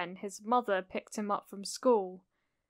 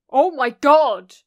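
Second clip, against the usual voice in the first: loud, higher outburst of alarm; shocked exclamation; 4 semitones higher, 13 dB louder than usual. A narrator's reading. Auntie sees Izuku fade to transparency, exclaiming loudly. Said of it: "Auntie exclaimed".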